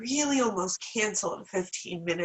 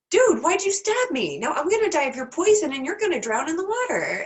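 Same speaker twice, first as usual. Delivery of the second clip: much higher, louder betrayed cry; indignant frog; 7 semitones higher, 5 dB louder than usual. Retold or imitated. Stabbed frog accuses scorpion, higher, louder outraged voice. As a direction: squawk like a stabbed frog in disbelief. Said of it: "the frog looks at him and says".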